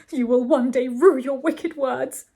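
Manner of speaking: loud, melodramatic warning slowing to a farewell; emphatic cry. A narrator's reading. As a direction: denounce emphatically like a jilted lover. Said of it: "he cried emphatically".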